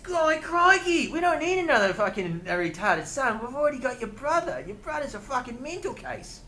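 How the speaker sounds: high-pitched, hammed-up Aussie accent; Australian accent. Shown as a demonstration. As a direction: put on a broad mock-Australian accent. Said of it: "That is Australian".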